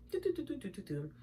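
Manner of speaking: very soft absent-minded doo-doo noises; tiny murmured syllables while occupied; idle doo-doo-doo. Retold or imitated. Imitating boyfriend busy on his phone, soft idle doo-doos. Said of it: "He's on his phone, right?".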